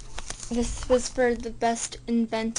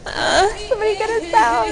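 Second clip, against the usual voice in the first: mock cry for help; 10 semitones higher, 9 dB louder than usual. Locked in mall, mock cry for help.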